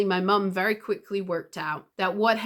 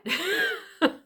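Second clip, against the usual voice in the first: high burst of laughter. Real, not acted. Laughing at the shared shock.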